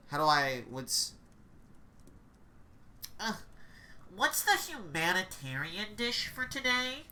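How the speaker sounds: usual speaking voice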